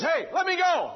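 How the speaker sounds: high, loud shouted demand; angel's shout. Acted. Angel wrestling Jacob shouts to be released. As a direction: shout it to break free of his grip. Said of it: "And finally the angel says".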